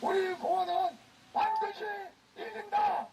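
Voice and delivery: roaring rallying speech. Real, not acted. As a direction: rouse the crowd with a roar. Leading crowd chant at rally.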